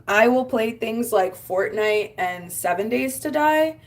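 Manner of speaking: normal talking voice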